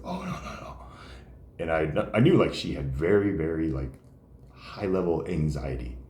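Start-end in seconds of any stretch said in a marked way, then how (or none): 0.1-0.8 whispered nervous refusal; mock timid refusal. Anxious Mary refusing to teach, in a whispered timid voice. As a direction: whisper it, shrinking away.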